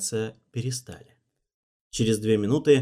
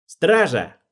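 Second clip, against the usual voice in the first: sharp loud bark, pitched up; barked order; 7 semitones higher, 8 dB louder than usual. A narrator's reading. Alvin barks for the guards. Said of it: "рявкнул Алвин".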